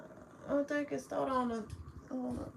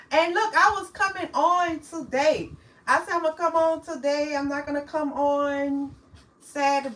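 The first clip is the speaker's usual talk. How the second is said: excited; 3 semitones higher, 9 dB louder than usual. Real, not acted. Telling chat about coming on.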